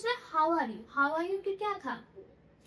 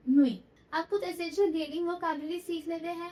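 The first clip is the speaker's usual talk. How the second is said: usual speaking voice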